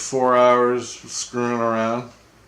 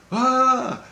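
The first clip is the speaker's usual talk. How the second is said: high-pitched "ah" from a dream; a sudden high yelp, imitated; short high mimicked cry; 14 semitones higher than usual. Recounting a dream of raising a hand, high cry.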